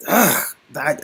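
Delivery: ordinary delivery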